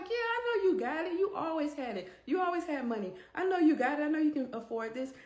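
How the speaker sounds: high-pitched, insistent coaxing; pushy. Retold or imitated. Sister-in-law pressing her to pay for everyone, pushy. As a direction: press the point as if it's obvious.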